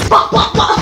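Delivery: high, laughing bah bah bah; mocking taunt. Kid mocking the beaten boy, high laughing taunt. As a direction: taunt him like a kid, cracking up.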